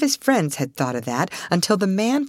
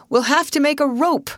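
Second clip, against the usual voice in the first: high, raised, urgent voice; urgent cry; 10 semitones higher, 4 dB louder than usual. A narrator's reading. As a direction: call out the plan quickly to everyone. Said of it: "cried Mister Town".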